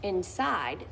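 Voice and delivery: ordinary delivery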